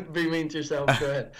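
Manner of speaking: said through a laugh. Real, not acted. Co-host joking about being mean to yourself, laughing. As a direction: tease through lingering laughter.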